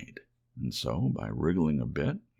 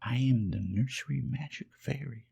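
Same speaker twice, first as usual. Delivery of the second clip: gentle fairy voice; 7 semitones higher than usual. Acted. Fairy comforting the lonely toy rabbit, high and gentle. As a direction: speak as a kind fairy, lifted high.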